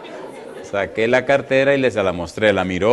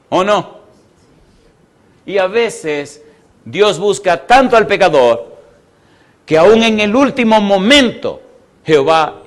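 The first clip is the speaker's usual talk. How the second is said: slow, raised sermon delivery building in force; emphatic preaching; 4 semitones higher, 4 dB louder, slower than usual. Real, not acted. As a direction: stretch and punch key words for the congregation.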